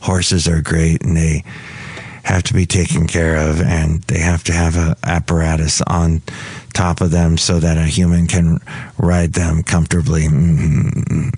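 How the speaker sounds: low, monotone character voice; deep, even-toned comic engineer; John Deere the engineer. Acted. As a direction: explain it earnestly, pitched well down.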